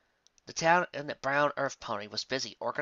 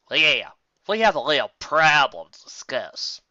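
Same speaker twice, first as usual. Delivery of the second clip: louder, slow cold menace; coldly; 3 semitones higher, 10 dB louder, slower than usual. Acted. Applejack confronts Sandalwood, slow and cold. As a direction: lay it down slow and icy.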